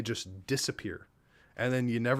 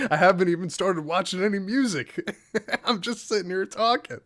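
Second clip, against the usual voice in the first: giddy laughing; 7 semitones higher than usual. Real, not acted. Giddy after big donation.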